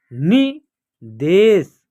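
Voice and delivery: निदेश drawn out, syllables apart; slow syllable by syllable. Shown as a demonstration. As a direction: read निदेश slowly in parts. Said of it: "या आप direct भी पढ़ सकते हैं". (निदेश धीरे-धीरे टुकड़ों में)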